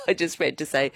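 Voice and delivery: normal talking voice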